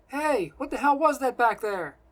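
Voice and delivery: high, loud angry demand; shouting over wind. A narrator's reading. Simon chases his friend in flight, shouting over wind. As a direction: yell to be heard over wind. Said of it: "he shouts over the wind".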